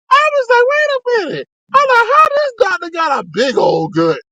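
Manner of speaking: very high shocked voice; gasping at the sight; mock shock. Shocked retelling, squeaky.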